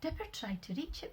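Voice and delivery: usual speaking voice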